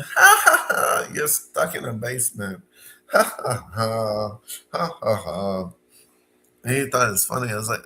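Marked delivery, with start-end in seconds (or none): 0.0-5.7 hammed-up taunting laugh at a stuck friend; mocking laughter. Friend mocking him stuck in the basement, taunting laugh. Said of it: "he started laughing".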